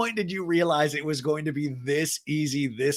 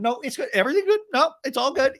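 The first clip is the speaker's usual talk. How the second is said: forced calm; 11 semitones higher, faster than usual. Retold or imitated. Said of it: "the Shannon".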